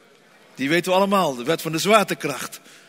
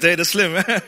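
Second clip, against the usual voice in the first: ordinary delivery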